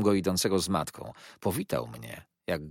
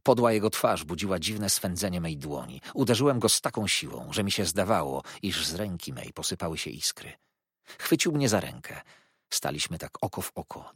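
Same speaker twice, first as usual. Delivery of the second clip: ordinary delivery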